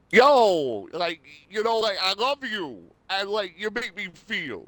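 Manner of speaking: high, loud New York voice saying I love you; a thick New York guy gushing. Shown as a demonstration. New York guy voice saying I love you to his girlfriend. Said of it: "now a New York guy".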